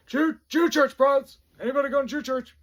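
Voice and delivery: high, very loud roll-call shout; drill sergeant yelling. Retold or imitated. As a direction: bellow it across the formation. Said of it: "Like, yelling".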